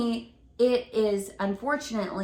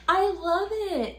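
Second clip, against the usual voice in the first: laughing; 9 semitones higher than usual. Real, not acted.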